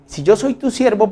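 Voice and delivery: "yo" pitched high, pressing the claim; mock-indignant servant reproaching God; indignant Moses. (Moisés indignado, "yo" alto)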